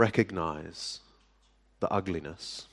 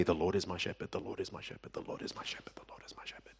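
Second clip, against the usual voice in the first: mock rote repetition, softer each time until only a whisper; fading to a whisper; 3 semitones lower, 13 dB quieter, much faster than usual. Repeat it like a mindless mantra, dying away into a whisper. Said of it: "they're only allowed to say".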